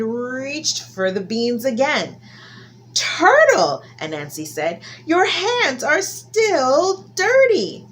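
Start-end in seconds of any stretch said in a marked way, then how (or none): 2.9-3.8 much higher, louder drawn call; a sly, exaggerated warning; Anansi scolding. Anansi stopping Turtle reaching again, high loud call. 5.1-7.9 much higher, louder drawn call; a sly, exaggerated warning; Anansi scolding. Anansi stopping Turtle reaching again, high loud call.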